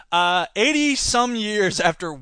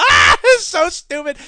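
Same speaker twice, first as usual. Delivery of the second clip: loud, high, slow laughing jeer; hooting at a dumb movie; laughing mockery; 8 semitones higher, 8 dB louder than usual. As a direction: laugh it out at the screen. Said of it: "we're laughing our heads off".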